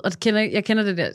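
ordinary talk

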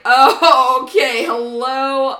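loud, slow "okay, hello" into the phone; loud, slow start to an urgent phone call; loud phone greeting. Retold or imitated. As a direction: announce yourself loudly and slowly on the call. Retold phone call opening.

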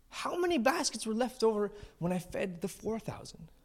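high, softened, strained questioning; frustrated rabbi. Acted. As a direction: quiz the disciples with acted exasperation. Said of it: "he's frustrated".

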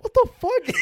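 very high, loud squeal of disbelief; mock shocked shriek. Cousins seeing the sister punched, mock shocked shriek. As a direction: shriek it, half laughing.

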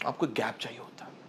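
hushed, confiding whisper. Real, not acted. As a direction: drop to a whisper to make the point. Confiding point to host. (फुसफुसाकर)

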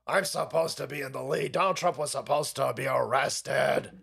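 low, grumbling mock sulk; mock pissed-off politician. Host voicing a pissed politician's thoughts, mock sulking. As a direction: grumble his inner complaint, half-hearted impression. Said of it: "He's sitting there going".

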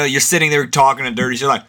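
ordinary talk

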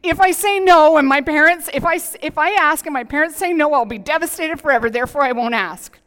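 put-on a light play on a worried child; mock anxious child. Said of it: "Your kids are not ... going like".

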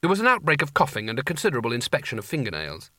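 usual speaking voice

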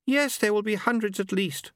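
high, prim and polite; Lady Lejeune voice. A narrator's reading.

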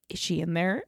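imitating a cautious low query; furtive retold question. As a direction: ask quietly so the next room won't hear.

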